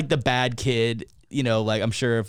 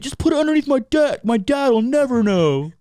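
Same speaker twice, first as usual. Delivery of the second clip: put-on high, loud kid hatching a plan; hammed-up high, loud scheming; mock scheming kid; 12 semitones higher, 8 dB louder than usual. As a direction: play an overexcited kid hiding evidence. Acts out kids hiding the tree.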